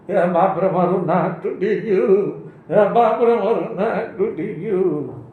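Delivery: wobbling, hammed-up cry of pain; clown's mock wailing. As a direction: wail it with a quavering comic wobble. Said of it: "તે રાડ પાડતો હતો".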